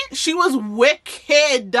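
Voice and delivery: "wicked" drawn out slowly; emphatic. Real, not acted. Telling viewers how evil the boss was, emphatic.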